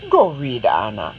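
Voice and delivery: low, calm motherly Go read; mother gently redirecting Anna; smiling mother. Acted. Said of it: "who smiles and says".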